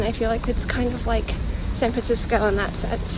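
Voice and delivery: ordinary talk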